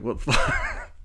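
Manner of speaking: breathy whispered aside; under-the-breath imagined reaction; mock whisper of shock; whispered disbelief. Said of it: "must have been like".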